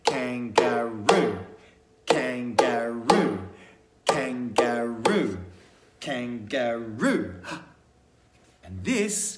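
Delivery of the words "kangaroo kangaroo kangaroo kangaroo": "kangaroo" slowly split into three beats; "kangaroo" chanted syllable by syllable. Shown as a demonstration. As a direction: beat out "kangaroo" as kan-ga-roo, slowly. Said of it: "His name has three beats".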